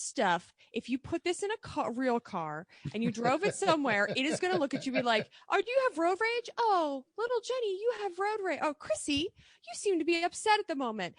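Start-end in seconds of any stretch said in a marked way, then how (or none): 5.5-11.1 high-pitched, mock-concerned robot nanny; cooing car assistant. Car consoling a driver with road rage, high mock concern.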